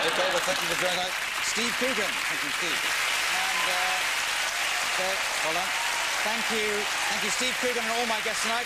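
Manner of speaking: host thanks guests and audience, announcer voice; show sign-off. Acted. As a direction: close the show with a big send-off.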